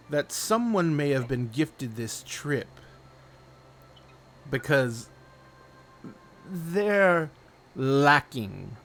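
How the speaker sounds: slow, drawn-out delivery with long pauses; sly wagering gentleman. Acted. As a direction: lay down the wager slowly, relishing each phrase.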